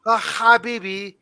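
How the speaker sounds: fast made-up Arabic patter; mock Arabic accent. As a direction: rattle off fake Arabic, then punch the last word. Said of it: "funny in Coach's impression, he actually said a real Arabic word".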